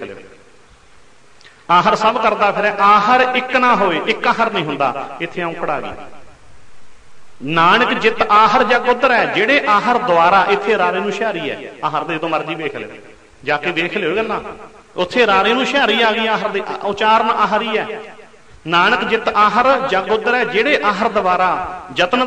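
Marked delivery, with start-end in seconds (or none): 1.7-4.0 slow, slightly raised recital of the verse; scripture recitation. Acted. Preacher recites a verse again. 7.4-9.3 slow, drawn-out recital of a holy verse; slow reverent verse reading to the congregation; scripture recitation. Acted. Preacher recites verse line. 18.7-20.4 slow, drawn-out recital of a holy verse; slow reverent verse reading to the congregation; scripture recitation. Acted. Preacher recites verse line.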